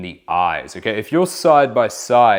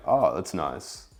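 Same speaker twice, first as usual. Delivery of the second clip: low, slow falling tune on oh that's nice; downward inflection; 5 semitones lower, 6 dB quieter than usual. Shown as a demonstration. Coach demoing a calm low falling acknowledgement. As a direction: let "Oh, that's nice." drop low and settle. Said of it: "it's kind of going down".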